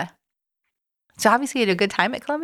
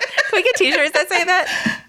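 laughing; 9 semitones higher, slower than usual. Real, not acted. Repeats joke laughing.

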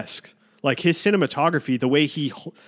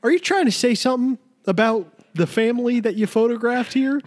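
high mock-offended questioning; indignant onlooker; 8 semitones higher than usual. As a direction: demand an explanation as a hammed-up critic. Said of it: "People were obviously like".

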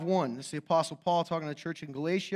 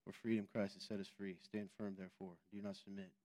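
much softer, lower bored mumble; 9 semitones lower, 16 dB quieter than usual. Shown as a demonstration. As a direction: mumble the verse like you've lost all interest. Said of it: "this is what I'm gonna do from now on".

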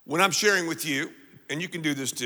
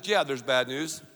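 normal talking voice

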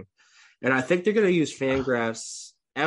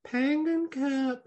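cutesy; 12 semitones higher, slower than usual. Real, not acted. Echoing cute cup name.

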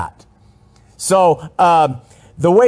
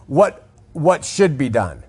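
normal talking voice

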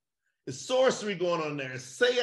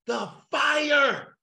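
shouted fervent answer; 5 semitones higher, 5 dB louder than usual. Real, not acted. Preaching climax, shouted answer.

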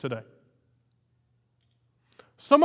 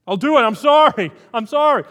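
louder, higher desperate apology; loud repentant plea; 6 semitones higher, 8 dB louder than usual. Retold or imitated. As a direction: cry out sorry like a broken man. Jonah's cry of repentance.

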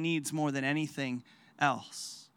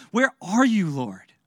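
crying out to God; 6 dB louder than usual. Retold or imitated. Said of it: "what we often come to Jesus crying out".

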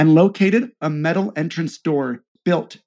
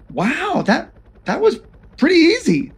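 amazed; 12 semitones higher than usual. Acted. Greenlee impressed.

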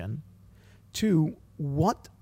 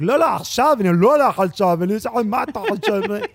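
loud, higher mock squabbling in Hebrew; imitating arguing toddlers; 4 semitones higher, 10 dB louder than usual. Parent mimicking three-year-old twins bickering in Hebrew, loud mock argument. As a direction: play little kids yelling over each other. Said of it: "just arguing in Hebrew with each other".

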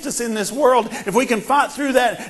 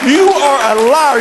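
loud rebuke, punching YOU; shouted accusation; 6 semitones higher, 10 dB louder, slower than usual. Retold or imitated. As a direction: throw it back at the devil. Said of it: "and you say".

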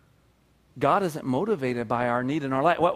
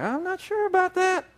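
much higher mock doubtful voice; skeptical congregation; 14 semitones higher than usual. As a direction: play the unsure crowd, hammed-up. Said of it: "You guys are like".

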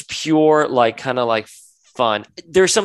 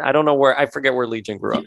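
normal talking voice

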